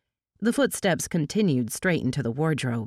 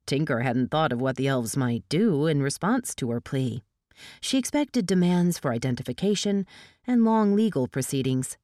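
plain reading voice

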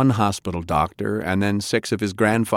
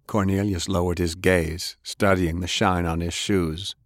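plain reading voice

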